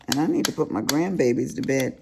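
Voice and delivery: ordinary delivery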